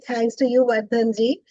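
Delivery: ordinary delivery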